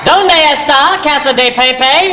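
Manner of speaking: high slow shouted Spanish accent. Shown as a demonstration. Tourist shouting directions in Spanish, hammed-up accent. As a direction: belt out the Spanish, syllable by syllable. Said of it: "You go".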